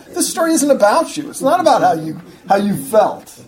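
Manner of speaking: high, loud imitation of a preacher's accent; emphatic, humorous accent impression; Alistair Begg's accent. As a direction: scold in a put-on preacher's accent. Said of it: "he says in his characteristic accent".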